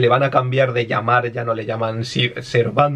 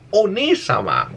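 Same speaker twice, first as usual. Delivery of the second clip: lightly imitated anime call, drawn out; imitación de personaje; 8 semitones higher, slower than usual. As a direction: stretch it slowly like an anime honorific. Imitates game character's respectful call. (imitando a un personaje de anime)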